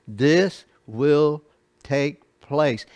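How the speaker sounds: "This will take place" drawn out slowly, weight on will; slow weighty declaration, will stressed; stress on WILL again, very slow. Shown as a demonstration. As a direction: spell it out, slow and certain. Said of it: "He says".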